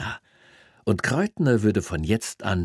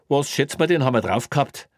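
smug cop voice; 7 semitones higher, 4 dB louder than usual. Acted. Cop questions speeding colleague.

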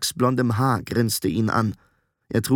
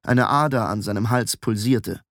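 usual speaking voice

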